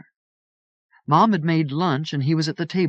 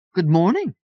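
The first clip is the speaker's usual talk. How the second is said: crisp pleasant aunt; 6 semitones higher, 4 dB louder than usual. A narrator's reading. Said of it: "Aunt Juliet's crisp, pleasant voice".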